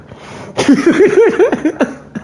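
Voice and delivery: high, loud burst of laughter. Real, not acted. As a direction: crack up loudly.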